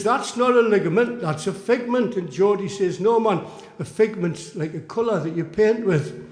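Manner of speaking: animated storyteller voicing two squabbling mates; comic quibbling dialogue. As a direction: bat the corrections back and forth. Performs poem's mounting quibble.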